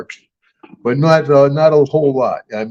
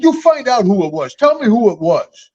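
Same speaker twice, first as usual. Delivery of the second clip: higher, insistent order, lightly played; mock demand; 8 semitones higher than usual. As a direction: press the clerk for a name. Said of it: "I said".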